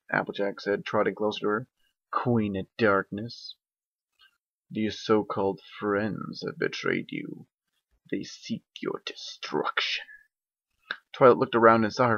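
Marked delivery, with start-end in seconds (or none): 2.1-10.1 slow, soft, menacing creature whisper; slow hushed dark spirit voice; sinister dark voice. Acted. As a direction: tempt her from the shadows. Dark dragon voice speaks.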